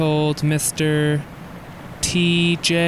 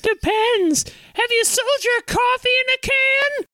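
much higher, bitter housewife voice; shrill wife voice. Acted. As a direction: play the fed-up wife, voice pitched way up. Plays wife in high voice.

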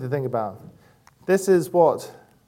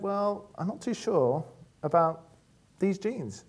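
higher, softer imitated girl; young daughter; 5 semitones higher, 4 dB quieter, slower than usual. Daughter back from shopping, lightly imitated unsure girl. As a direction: gently mimic an unsure child, slowing down. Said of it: "she said".

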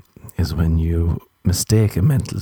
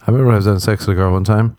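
ordinary talk

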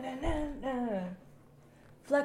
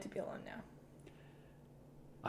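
whispered; 21 dB quieter, much slower than usual. Pretend. Mom sends son away.

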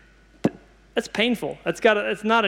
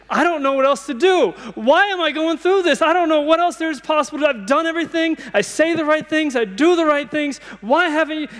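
desperate plea; 7 semitones higher than usual. Acted. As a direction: pour out frustration, pitched up and pushing. Desperate prayer acted out to God.

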